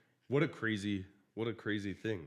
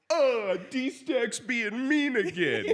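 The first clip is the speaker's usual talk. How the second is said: slow, higher mocking whine with a laugh; mock whiny complaint; 9 semitones higher, slower than usual. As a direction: imitate a hurt coworker whining, hammed-up. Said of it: "And he goes".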